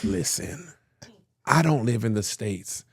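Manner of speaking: quiet, hushed, sorry-sounding ask; soft apologetic plea. Retold or imitated. Fan at the mall, quietly begging a grieving man for a photo. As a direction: beg gently for the favour.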